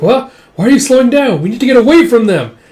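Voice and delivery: high, loud alarmed cries; panicked. Acted. Erica panicking during the chase, loud alarm.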